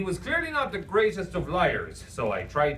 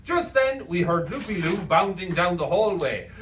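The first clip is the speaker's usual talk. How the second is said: usual speaking voice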